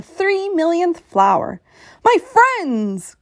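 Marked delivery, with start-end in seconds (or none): 2.0-3.2 higher, louder cry of relief; joyful cry. A narrator's reading. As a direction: shout with joy at finding them. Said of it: "cried Horton".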